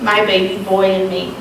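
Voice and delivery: ordinary talk, nothing marked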